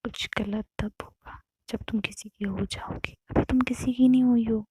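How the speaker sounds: intimate hush after a shush; soothing whisper. Retold or imitated. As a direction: whisper it close, coaxing her. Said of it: "तो कहती, श्श".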